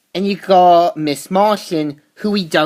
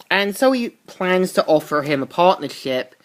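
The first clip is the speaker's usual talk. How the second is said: ordinary talk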